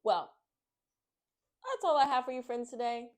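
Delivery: ordinary delivery, nothing marked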